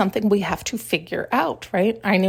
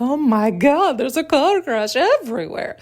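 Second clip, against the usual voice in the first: higher and louder mock panic; mock alarm; 5 semitones higher, 3 dB louder than usual. Mocking how everyone reacts to one car crash, mock alarm. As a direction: play the overblown horror of the crowd.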